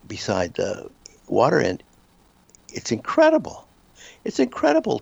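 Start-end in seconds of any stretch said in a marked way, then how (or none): 2.7-5.0 amazed. Real, not acted. Recalling amazing meditation.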